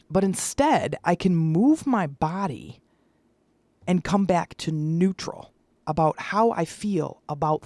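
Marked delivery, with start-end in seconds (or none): none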